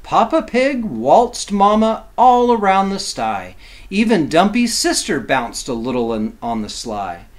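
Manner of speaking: lively, sing-song picture-book reading; reading to children. A narrator's reading. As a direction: read it like bedtime rhyme for kids.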